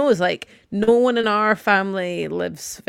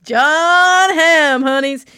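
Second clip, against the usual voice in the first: higher and louder, swooning over a crush; gushing excitement; 7 semitones higher, 7 dB louder, much slower than usual. Real, not acted. To her co-host, swooning over Jon Hamm, higher and louder. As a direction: swoon over the name like a fan.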